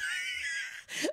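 very high drawn-out squeal; comic shriek. Acted. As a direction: cap the rant with a piercing mock shriek. Shriek capping the protest.

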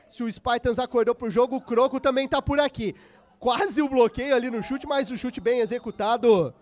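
ordinary talk

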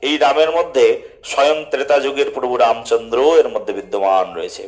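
usual speaking voice

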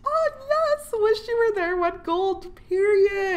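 high excited squeal. Real, not acted. Gamer squealing over the game wife, high and thrilled.

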